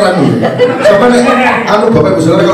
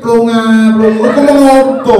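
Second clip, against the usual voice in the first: mock Javanese; 4 semitones higher, 3 dB louder, slower than usual. Wife imitating his Javanese chat.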